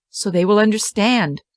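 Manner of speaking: ordinary delivery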